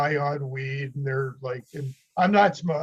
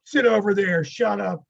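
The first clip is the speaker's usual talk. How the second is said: louder, higher imitation of a curt command; mock police order; 4 semitones higher, 6 dB louder than usual. Orange County officer to a detainee, mock stern order. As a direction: order a suspect around, retold.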